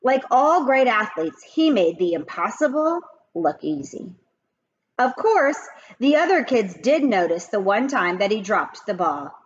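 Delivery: ordinary delivery, nothing marked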